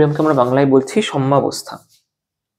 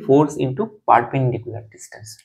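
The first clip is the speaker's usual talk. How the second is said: very slow, deliberate definition; slow dictation; much slower than usual. Shown as a demonstration. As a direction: stretch the definition out. Teacher states the definition.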